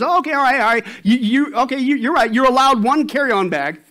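acted flustered gatekeeper backing down; flustered conceding. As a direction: give in, tripping over your words.

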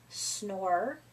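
low soft snoring sound; snore. Acted. Narrator to class, a low snore. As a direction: mimic a sleepy snore.

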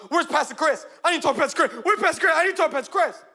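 high, insistent, urgent repeated demand; frantic demand. Retold or imitated. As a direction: imitate a frantic stranger barging in. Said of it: "she goes".

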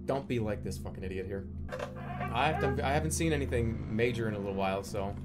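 ordinary talk

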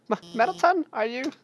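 stammering high-pitched fear; panicked high cry. Acted. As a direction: cry his name in panic. Calling to the fallen robot.

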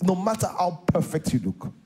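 ordinary delivery

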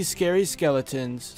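usual speaking voice

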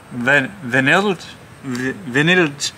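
"vanilj" tried again and again, slowly, as "vi-NILJ"; stumbling slowly over "vanilj"; sounding out "vanilj" with halting retries; slow repeated attempts. Shown as a demonstration. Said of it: "I cannot do accents".

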